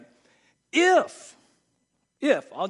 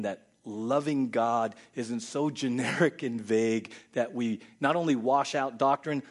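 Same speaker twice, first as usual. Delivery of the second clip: ordinary delivery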